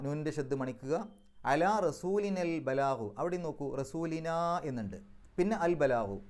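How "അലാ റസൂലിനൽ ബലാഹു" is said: very slow chant; Quranic recitation. Shown as a demonstration. Teacher chants the verse slowly for the class. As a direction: draw out each syllable in recitation. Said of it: "recites verse for class".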